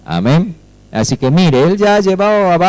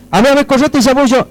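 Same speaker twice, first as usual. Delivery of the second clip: higher, hurried butting in; pushy helper; 7 semitones higher, faster than usual. Acted. Would-be helper taking over someone's repair, pushy and hurried. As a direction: shove in fast to take over.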